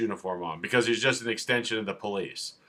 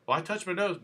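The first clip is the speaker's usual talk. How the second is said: higher, softer mock protest; mock protesting driver; 5 semitones higher, 5 dB quieter than usual. Driver answering the officer who failed him, mock meek protest. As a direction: plead your case lightly as the driver.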